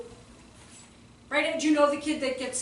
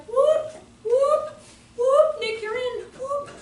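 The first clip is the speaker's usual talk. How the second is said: high, comic whoops; mock graceful exit; 10 semitones higher, 5 dB louder, much slower than usual. Teacher tagging in a colleague, comic high whoops. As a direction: whoop your way out while handing over. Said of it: "gracefully be like".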